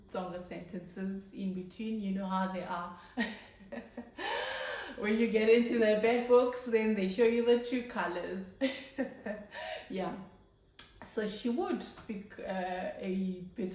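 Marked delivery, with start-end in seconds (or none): 3.6-4.9 a high little laugh; high knowing laugh about moms; high chuckle. Real, not acted. 5.0-8.4 louder teasing punchline about moms turning strict. Real, not acted. Joking about angry moms. 8.5-9.8 breathy laugh after the mom joke. Real, not acted.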